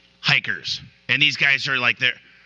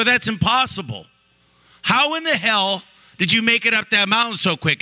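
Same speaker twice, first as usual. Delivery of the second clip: mildly acted disbelief, pitched higher; incredulous; 8 semitones higher than usual. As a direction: voice stunned hikers questioning her feat. Disbelieving hikers question mother.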